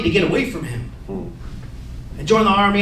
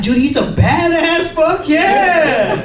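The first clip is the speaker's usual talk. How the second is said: high, drawn-out dad bragging; hyped-up pride; 7 semitones higher, 4 dB louder, much slower than usual. Retold or imitated. Dad hearing his son is a tough cookie, hyped-up pride. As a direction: crow it loudly with long vowels. Proud dad reacts to doctor's praise.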